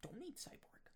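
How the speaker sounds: hushed, sneaky whisper; mock studio exec whisper. Exec whispering to colleagues, hushed and sneaky. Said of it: "we were like".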